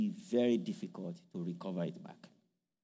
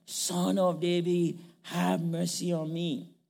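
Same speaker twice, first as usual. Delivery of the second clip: shouting more; 7 semitones higher, slower than usual. Acted. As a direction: plead slowly, reaching upward. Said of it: "he shouted the more".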